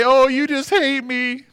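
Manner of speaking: high, slow, whiny put-on voice; a hurt, sulky whine drawn out; mock whine. Preacher mocks a defensive church member, high slow whine.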